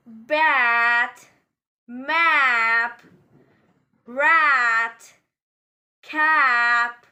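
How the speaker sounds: "bat, map, rat, cap" with a long, loud, open A; open A sound. Shown as a demonstration. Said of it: "You need to widely open your mouth".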